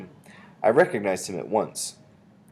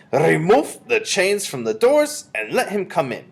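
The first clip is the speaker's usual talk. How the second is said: slow, high, loud witch's order; commanding witch; 10 semitones higher, 6 dB louder, slower than usual. A narrator's reading. Grand High Witch has the boy let in, commanding. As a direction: give the order slowly as a foreign witch. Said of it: "said the Grand High Witch".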